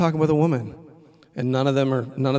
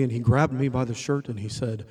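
ordinary talk, nothing marked

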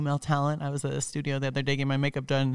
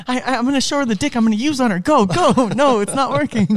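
higher, louder hammed-up strain, then giving up; mock straining patient; 8 semitones higher, 6 dB louder than usual. Patient trying to perform for her, hammed-up strain. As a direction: ham up a guy failing to perform.

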